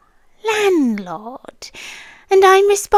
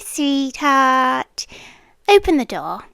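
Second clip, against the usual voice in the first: ordinary delivery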